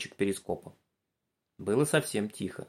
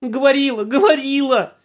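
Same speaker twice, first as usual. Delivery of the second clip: high whiny whimper, louder; 10 dB louder than usual. Acted. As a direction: whine it again tearfully. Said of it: "хныкала Галка".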